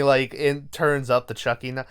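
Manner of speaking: ordinary delivery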